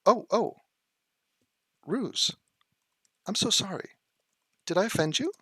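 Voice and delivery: quiet sarcastic sympathy; mocking a chatter before kicking him; mock apology. Real, not acted. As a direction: fake sorry, dripping sarcasm.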